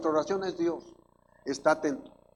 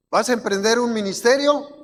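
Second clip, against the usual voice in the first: raised, loud rhetorical question; preacher's challenge; 6 semitones higher, 7 dB louder than usual. Real, not acted. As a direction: throw the question out to the congregation.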